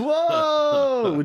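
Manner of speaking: high, drawn-out stoner whoa; long self-parodying stoner exclamation; mock stoner. Shown as a demonstration. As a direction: play up a blissed-out dude. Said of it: "Is that why I sound like this".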